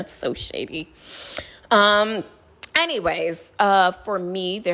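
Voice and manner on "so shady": muttered aside. Real, not acted. Quiet aside after laugh.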